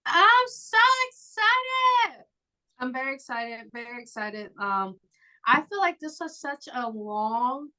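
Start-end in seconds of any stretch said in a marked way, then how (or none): 0.0-2.2 very high, slow, squealed excitement; excited squeal. Pretend. Asked if excited for season. 2.8-4.5 much softer, deadpan "very excited"; deadpan quiet. Pretend. Asked if excited for season.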